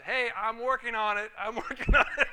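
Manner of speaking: loud reply breaking into laughter; laughing reply. Retold or imitated. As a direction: laugh it off loudly. Joking reply to pastor.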